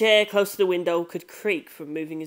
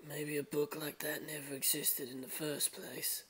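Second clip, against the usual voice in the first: quiet musing; 17 dB quieter than usual. Acted. Futaba voiced, quietly.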